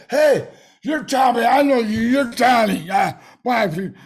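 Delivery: loud, insistent shouting at the star; pushy fan. Retold or imitated. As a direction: shout like a fan demanding an autograph. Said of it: "one guy there that was demanding an autograph".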